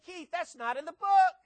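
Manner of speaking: high, loud mocking protest voice; hammed-up churchgoer crying foul; mock objector. Churchgoer protesting to the preacher, loud hammed-up objection. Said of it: "You say".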